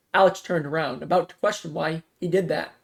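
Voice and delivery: usual speaking voice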